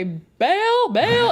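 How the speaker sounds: high, drawled Southern coach calling out a name; Coach Green impression. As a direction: call it out loud and high-pitched, mimicking the coach. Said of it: "impersonate Coach Green".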